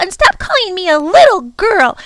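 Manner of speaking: put-on high, loud protest on little girl; indignant girl. Lindy protesting to the Prock, high and indignant. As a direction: bristle at being called little girl. Lindy objects to being belittled.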